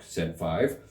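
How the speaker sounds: ordinary delivery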